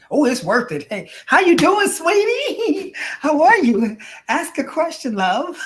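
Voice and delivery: high, loud, gushing greeting with a laugh; gushing viewer. Retold or imitated. Reading a viewer's comment aloud: high gushing coo. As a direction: play the viewer cooing over her, way up high. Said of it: "She's like".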